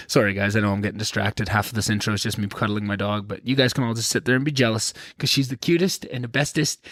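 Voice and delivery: ordinary delivery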